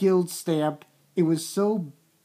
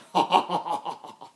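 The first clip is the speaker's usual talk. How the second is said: mock evil villain cackle; villain laugh. A recapper acting out the villain, crazy evil laugh. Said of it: "crazy villain moment".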